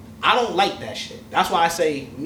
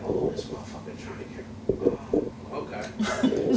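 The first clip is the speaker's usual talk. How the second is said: whispered inner thought; 2 semitones lower, 6 dB quieter than usual. Retold or imitated.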